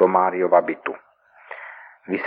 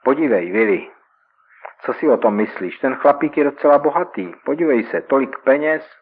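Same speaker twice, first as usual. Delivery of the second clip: excited; 5 semitones higher, 3 dB louder than usual. Acted. Robber thrilled by banknote roll.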